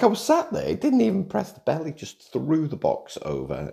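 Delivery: mock outrage at the delivery guy; indignant disbelief. Real, not acted. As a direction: sound scandalised he skipped the bell.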